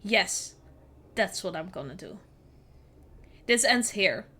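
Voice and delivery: ordinary delivery, nothing marked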